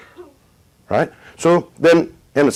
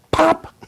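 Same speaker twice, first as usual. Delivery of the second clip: a sudden high squeaky pop; bulb popping. Retold or imitated. Light bulb bursts in story.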